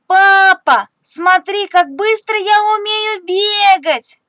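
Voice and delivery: high, loud, slow boastful cry; excited shout. Acted. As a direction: call out proudly to dad. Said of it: "кричит Тим". (радостно и громко кричит папе)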